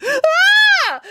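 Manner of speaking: loud, very high sustained scream; piercing shriek of horror; horror-movie scream. A narrator's reading. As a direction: scream like a horror-film victim. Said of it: "Matilda screamed".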